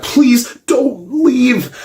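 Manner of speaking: high, slow, drawn-out begging; hammed-up desperate plea; mock pleading. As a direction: plead like an overexcited fan. Acting out begging Godzilla at door.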